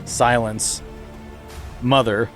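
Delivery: usual speaking voice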